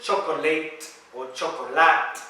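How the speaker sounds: "chocolate" in three syllables, ending late, then lat; mispronunciation demo. Shown as a demonstration. Teacher showing what it isn't in English, mispronounced. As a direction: sound the middle O in "chocolate" and a full last vowel. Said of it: "in English isn't".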